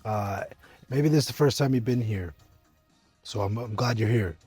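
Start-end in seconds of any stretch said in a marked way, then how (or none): none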